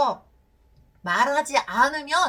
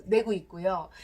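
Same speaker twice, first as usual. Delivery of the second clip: normal talking voice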